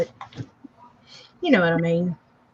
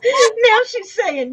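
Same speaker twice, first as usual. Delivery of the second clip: talking through laughter; laughing; 11 semitones higher, slower than usual. Real, not acted. Reading her friend's comment while laughing hard.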